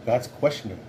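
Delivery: ordinary delivery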